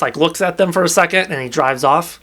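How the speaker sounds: usual speaking voice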